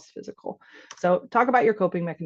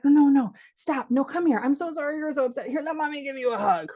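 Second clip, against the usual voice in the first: fussing parent; 6 semitones higher than usual. Acted. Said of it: "natural parent instinct".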